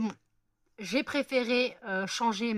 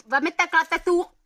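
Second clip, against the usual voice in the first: higher, louder teasing echo of the slip; mock repeat; 9 semitones higher, 10 dB louder than usual. Teasing her friend's slip, a high, louder echo. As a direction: tease the friend's slip in a raised voice.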